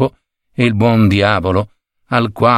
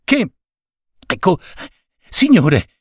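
plain reading voice

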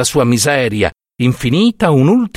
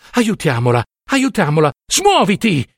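insistent plea climbing high; acted impatient appeal, rising in pitch; urgent urging; 7 semitones higher than usual. A narrator's reading. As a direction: urge him harder with each word. Wife urging husband to help.

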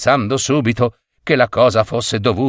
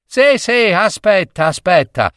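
higher-pitched, impatient comeback; scornful retort; 8 semitones higher than usual. A narrator's reading. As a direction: cut off the husband's promises. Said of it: "ribatté la donna". (replica stizzita e impaziente)

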